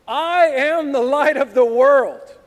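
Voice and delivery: loud, high, slow declaration; booming proclamation. Acted. Jesus standing up before the people at the feast, booming proclamation. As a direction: proclaim it out to a crowd, drawn out.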